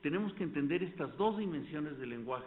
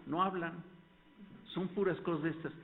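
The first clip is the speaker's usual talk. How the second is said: ordinary talk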